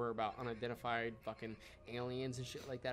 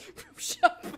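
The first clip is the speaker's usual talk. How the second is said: laughing disbelief; 10 dB louder, much slower than usual. Real, not acted.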